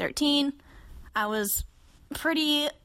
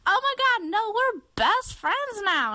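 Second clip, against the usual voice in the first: put-on high, drawn-out drunken squeal; hammed-up drunk enthusiasm; drunk gushing; 12 semitones higher than usual. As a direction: imitate a tipsy girl declaring friendship. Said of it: "so drunk. So she was like".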